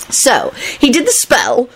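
high, drawn-out glee; gleeful, slow high squeal; happy screech. A narrator's reading. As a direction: launch the story gleefully. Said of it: "Elizabeth screeched happily".

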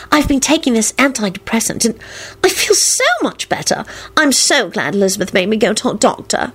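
high-pitched happy confidence; happy confiding. A narrator's reading. Solange telling Quentin about her antidepressant, happy. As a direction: confide it with relief. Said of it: "she nodded happily".

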